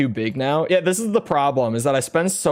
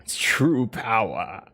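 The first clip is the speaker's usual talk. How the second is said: slow, lower villain voice; Psycho Mantis impression; 5 semitones lower, 4 dB quieter, slower than usual. Streamer practises a game villain's line, slow imitation.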